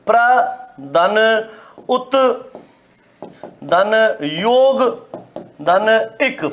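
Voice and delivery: very slow word breakdown. Shown as a demonstration. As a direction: dictate each part slowly. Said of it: "कैसे बना?".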